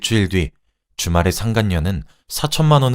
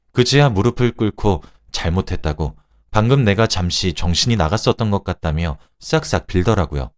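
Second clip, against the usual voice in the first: plain reading voice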